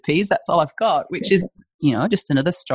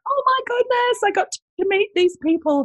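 much higher, gushing fan voice; starstruck gushing. Retold or imitated. Franchisee gushing about meeting industry heroes, starstruck. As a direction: imitate a thrilled fan, pitched way up.

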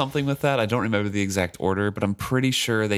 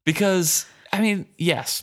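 laughing; 10 semitones higher, slower than usual. Real, not acted. Laughing about Malta.

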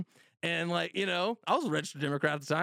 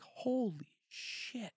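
higher, drawn-out and hushed in shock; higher, slow, softer stunned gasp; 9 semitones higher, 6 dB quieter, much slower than usual. Retold or imitated. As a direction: let it slowly fall out of you in awe. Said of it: "I was like".

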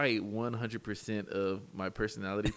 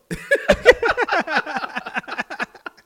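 very high, loud snickering laugh; mock Butthead snicker, high and loud; cartoon laugh; 14 dB louder than usual. Recalling the brother's cartoon voice, very high laugh.

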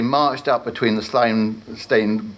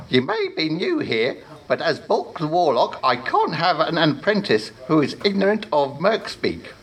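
self-important higher warlock voice; pompous warlock; 6 semitones higher than usual. Acted. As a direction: lecture like a vain wizard boss. Warlock lectures new apprentice.

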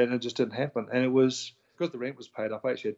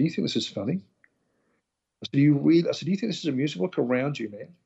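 raised pitch, a reliving of angry disbelief; retold indignant outburst; 4 semitones higher, 3 dB louder than usual. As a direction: confront him with mock outrage, pitch climbing. Confronting the laughing yacht club manager.

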